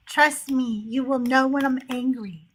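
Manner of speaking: ordinary talk, nothing marked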